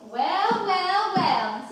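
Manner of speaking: effusive greeting. Acted. Mother greets son's guest.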